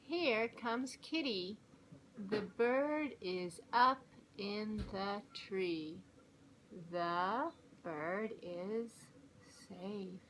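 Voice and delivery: slow, careful early-reader voice; reading to children. A narrator's reading. Mom reading a level-four book to kids, slow and clear. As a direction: read it out word by word for young kids.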